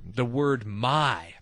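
slow, weighted "my"; stress on my. Shown as a demonstration. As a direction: lean into the word "my". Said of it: "one of my favorite words".

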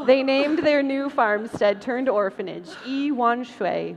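normal talking voice

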